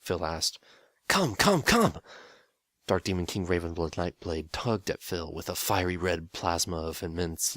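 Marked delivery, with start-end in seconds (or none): 1.1-2.0 high, excited come come come; eager urging. Acted. The Demon King pulling Phil to the sitting room, eager. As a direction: drag him along, thrilled.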